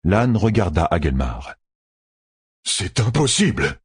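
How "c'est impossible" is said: higher-pitched cry of disbelief; disbelieving exclamation. A narrator's reading. Lord reacts to news of the enemy horde, exclaiming in disbelief. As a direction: exclaim it in shock, without shouting. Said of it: "s'écria le seigneur".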